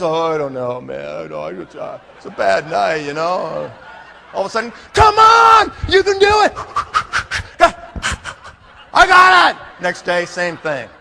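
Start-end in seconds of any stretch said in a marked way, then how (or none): 0.0-3.7 low, dejected excuse-making; deflated. Acted. Recruit begs off the next day, acted deflated voice. 4.9-6.5 high, very loud cheerleading shout; pumping someone up. Acted. Leader re-inflates a deflated recruit, acted rousing shout. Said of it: "You pump 'em up". 8.9-9.6 high, loud fired-up shouting; all pumped up. Acted. Recruit answers the pep talk, acted pumped-up shout. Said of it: "Guys all pumped up".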